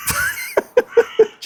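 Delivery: high burst of laughter. Real, not acted. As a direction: let a big laugh out. Loud laugh at the colour.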